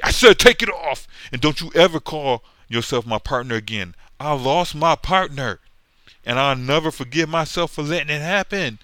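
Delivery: hammed-up angry yell; furious hero. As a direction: bellow at him like a wounded hero. Acted quarrel, hero yelling.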